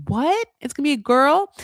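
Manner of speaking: high disbelieving rising question; mock shock. [name] echoing Johnny's news, mock shocked disbelief. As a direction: echo it back in mock disbelief.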